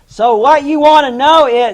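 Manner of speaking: loud, high imitation of a hard-of-hearing elder; retold yelling. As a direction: shout it as if to someone deaf. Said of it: "we yelling at each other".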